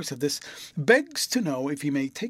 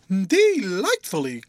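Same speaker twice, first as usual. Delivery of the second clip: louder, slow, drawn-out Delightfully; spontaneous delight; 4 semitones higher, 6 dB louder, slower than usual. A narrator's reading. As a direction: let Delightfully burst out freely. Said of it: "in a quite spontaneous manner".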